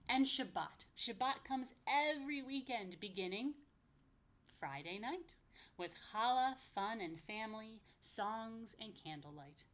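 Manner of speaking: sing-song rhyming read-aloud, slow on the lists; lively picture-book reading; reading to children. Acted. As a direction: bounce the rhymes for little listeners.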